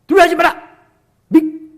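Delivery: loud, high scolding shout; harsh rebuke. Shown as a demonstration. Preacher demonstrating the wrong way to comfort, scolding shout. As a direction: shout it as a stern reproach. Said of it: "이게 아니에요. 굉장히 따뜻하게".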